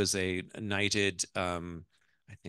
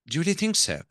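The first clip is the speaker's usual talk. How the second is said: put-on high, louder English-accented wife; 8 semitones higher, 6 dB louder, faster than usual. Wife seeks reassurance from mother-in-law, English accent. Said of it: "I'm gonna do the ... the accent".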